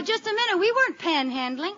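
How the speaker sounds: ordinary delivery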